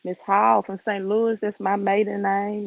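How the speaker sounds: ordinary talk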